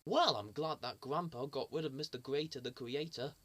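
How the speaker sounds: normal talking voice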